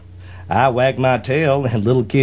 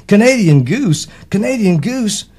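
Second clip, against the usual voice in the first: higher, delighted repeat of the name; said with a grin; 9 semitones higher, 4 dB louder than usual. A narrator's reading. Duck greeting the goose, higher and grinning. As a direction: savor the name, grinning and eager. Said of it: "Quacker said with a grin".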